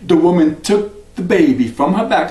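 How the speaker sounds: ordinary delivery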